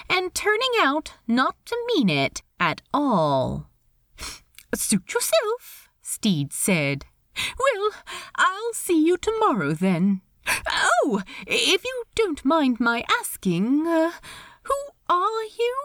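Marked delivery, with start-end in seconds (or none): 4.7-5.9 high, light character voice; lilting high-pitched reply; Steed. Acted. Steed's dialogue voice. 7.6-15.8 high, lilting, polite voice; awkward host in a high register; Steed, politely awkward. Acted. Steed talking to Ed.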